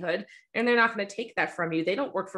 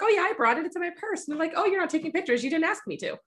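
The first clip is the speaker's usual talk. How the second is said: high, sing-song fake innocence; innocent smart-aleck; 10 semitones higher than usual. Photographer teasing her friend about the camera, faux innocent. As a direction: play dumb, sweetly and pitched up. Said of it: "And I'm like".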